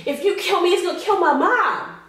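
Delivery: high pleading plea. Retold or imitated. Woman retelling pleading with the gunman, high desperate plea. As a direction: beg him, thinking of mom. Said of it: "So I start pleading for my life".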